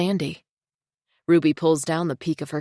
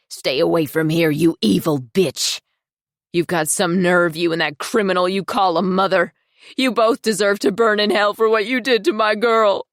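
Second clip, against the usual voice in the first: angry; 6 dB louder than usual. Acted. Mother confronting Ruby.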